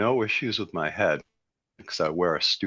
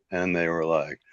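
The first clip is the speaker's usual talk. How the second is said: normal talking voice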